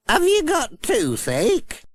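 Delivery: put-on high, slow, kindly old lady voice; Granny Dryden. A narrator's reading. Granny Dryden spotting Pat's toothache, high slow old-lady voice. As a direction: fuss like a caring grandmother.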